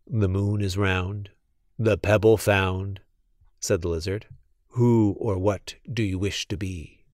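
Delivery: put-on a solemn old lizard, speaking softly; low mysterious lizard voice; magic lizard.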